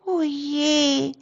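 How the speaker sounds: high, soft squeak of a little mouse; squeaky mouse. Acted. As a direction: peep it like a tiny worried mouse. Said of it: "piepste die kleine Waldmaus Rudi". (piepsige Mausstimme)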